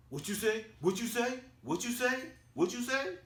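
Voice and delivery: imitating someone itching to fight, very high; high-pitched confrontational challenge, climbing with each repeat; mock crazy aggression. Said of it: "Marcus Peters just goes crazy".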